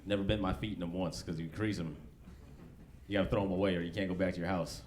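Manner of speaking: ordinary talk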